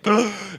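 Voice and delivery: sharp gasp for air; demonstrated gasp. Shown as a demonstration. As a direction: act out a gulp of breath. Demonstrates breath held under water.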